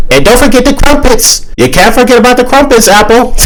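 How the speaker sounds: very high cartoonish British accent; Onion with British accent. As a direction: chirp it in hammed-up British. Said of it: "act all British and whatnot".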